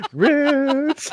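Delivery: long, high-pitched drawn-out campy holler; Paul Lynde. Retold or imitated. Shouting at a passing actor out of nowhere, Paul Lynde impression. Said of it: "that Paul Lynde voice".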